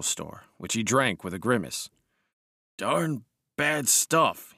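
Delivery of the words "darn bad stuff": grumbling officer voice. Acted. Lewis after grimace.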